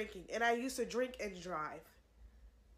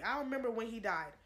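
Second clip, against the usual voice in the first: ordinary delivery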